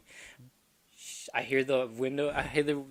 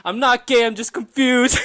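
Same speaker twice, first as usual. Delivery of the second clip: high, loud, hammed-up protest; shouted protest; 11 semitones higher, 15 dB louder than usual. Calling to the stripper as the car door closes, shouting. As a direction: yell it after someone leaving, near laughter.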